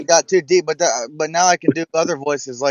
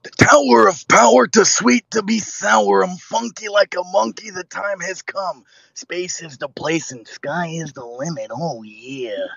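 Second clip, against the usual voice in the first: Macho Man; 2 semitones higher, slower than usual. Acted. Wrestler putting on a show, slow rhyming promo that fades. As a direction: start big, then wind down slowly in a wrestler's voice. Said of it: "That's a great Macho Man".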